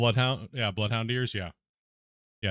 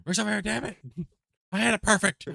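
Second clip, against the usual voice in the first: mock rage; 9 semitones higher than usual. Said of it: "raging at me right now".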